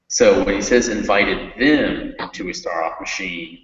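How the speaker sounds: them punched, rest of the line even; teacher shows the emphasis on them; stress on them. Shown as a demonstration. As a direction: hit the word them in invited them into. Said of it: "put the emphasis in that sentence on that word".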